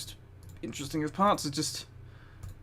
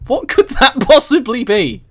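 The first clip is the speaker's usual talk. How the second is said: louder, higher incredulous question; baffled by the phrase; mock-baffled loud question; 5 semitones higher, 9 dB louder than usual. Real, not acted. Incredulous question about book.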